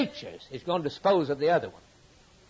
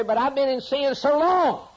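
mock lament; 10 semitones higher, 4 dB louder than usual. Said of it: "you say".

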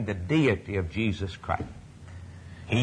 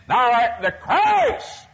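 high, slow, loud proclamation; soaring cry; 10 semitones higher, 4 dB louder, slower than usual. Acted. Preacher crying out the believers' confession, soaring. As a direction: lift it and hold it.

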